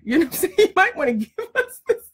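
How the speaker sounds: real laughter breaking through the words; laughing. Real, not acted. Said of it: "I'm laughing".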